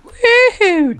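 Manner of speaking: high whoop; excited cheer. Real, not acted. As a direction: whoop along. Echoing cheer.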